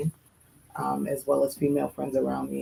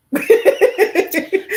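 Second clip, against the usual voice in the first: loud laugh; 11 dB louder than usual. Real, not acted. Host bursting out laughing at her own joke.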